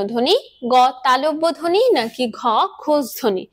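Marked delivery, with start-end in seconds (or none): none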